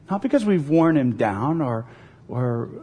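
ordinary talk

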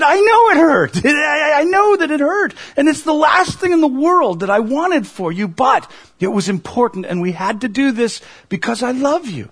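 high, loud tearful voice breaking through tears; a father crying as he comforts his son. Retold or imitated. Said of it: "I started crying too".

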